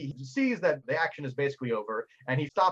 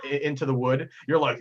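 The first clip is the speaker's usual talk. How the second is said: ordinary talk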